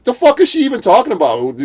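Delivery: high, loud mock disbelief; imitated incredulous friend. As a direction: exclaim it as a baffled buddy would. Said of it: "And, and he's like".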